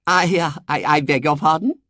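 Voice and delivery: high, hesitant gentlemanly query; polite young man. A narrator's reading. Young man surprised by intruders, polite. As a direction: stammer a courteous question. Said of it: "he said politely".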